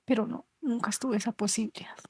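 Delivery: telling the interviewer of a lost mother, whispered in grief. Real, not acted. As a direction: let it fade into a broken whisper. End of grief-filled recollection.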